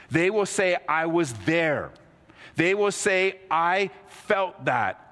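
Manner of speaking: high, loud, driving preacher's voice building the case; fervent preaching. Acted. Preacher challenging the congregation, fervent high preaching. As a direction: preach it hard and high to the room.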